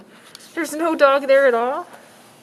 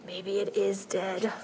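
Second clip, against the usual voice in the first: ordinary delivery, nothing marked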